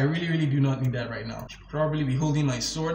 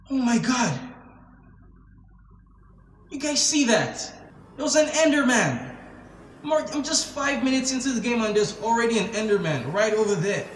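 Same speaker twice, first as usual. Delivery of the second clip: 1 startled; 6 semitones higher than usual. Real, not acted. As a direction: gasp it out, caught off guard. Spots an Enderman in game.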